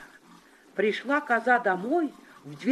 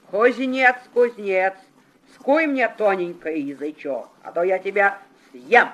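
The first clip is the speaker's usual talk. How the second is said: loud, menacing wolf demand; loud wolf threat; 5 dB louder than usual. Acted. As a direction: threaten the smith loudly.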